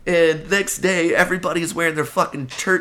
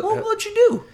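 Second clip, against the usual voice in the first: much higher, sugary sales-clerk voice; sweet-talking a cute girl, pitched way up; customer service voice; slower than usual. Acted. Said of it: "That was my customer service voice".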